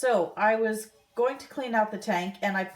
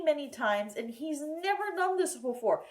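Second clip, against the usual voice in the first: usual speaking voice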